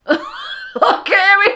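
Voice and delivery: very high, loud, laughing shriek; hysterical laughter. Real, not acted. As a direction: burst out laughing through the words. Laughing at the film's love scene.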